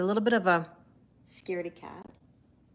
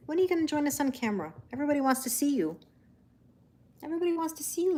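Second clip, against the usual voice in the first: pet talk; 7 semitones higher, much faster than usual. Real, not acted. Coaxing Taro onto camera.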